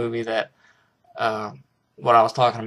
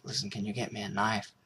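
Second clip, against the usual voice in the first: much softer, drained and pleading; weak hushed plea; 2 semitones lower, 10 dB quieter than usual. Retold or imitated. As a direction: barely get the words out to the boy. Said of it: "Wahlberg's asking for a knife".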